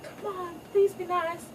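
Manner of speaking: high, sweet begging voice; high girlish plea for family; pleading daughter. Retold or imitated. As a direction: plead for peace like a child. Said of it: "Kiara's like".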